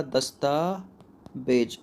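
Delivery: दस्तावेज stretched very slowly, "das-taa-vej"; slow syllable demonstration. Shown as a demonstration. Teacher showing how it is written, very slow दस्तावेज. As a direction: draw out each syllable of दस्तावेज as if writing it. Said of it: "कैसे लिखा जाएगा".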